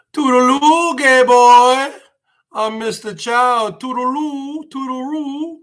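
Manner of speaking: put-on high-pitched, drawn-out caricature of a Chinese accent; hammed-up Mister Chow-style Chinese accent; mock Chinese accent. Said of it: "Chinese accent there".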